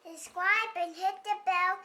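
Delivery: nice and loud. Real, not acted. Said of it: "Nice and loud".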